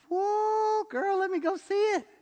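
high, squealing whoa of delight; amazed delight. Retold or imitated. To a friend about her new car, high amazed squeal. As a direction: gush over a friend's shiny new car.

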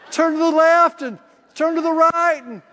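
high, loud comic protest; mock exasperation. Preacher mocking the need for step-by-step guidance, exasperated. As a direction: pile up the options, pitched high and loud.

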